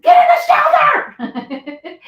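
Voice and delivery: long stretched high yell; shout. Retold or imitated. Mother yelling at her son to get in the shelter. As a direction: bellow it across the house.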